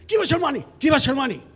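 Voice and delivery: high, loud robber's demand; yelling. Retold or imitated. Two muggers demand cash from frozen victim, yelling. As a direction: yell it like a mugger imitated at full force. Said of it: "they're yelling at me".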